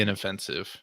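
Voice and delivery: ordinary talk, nothing marked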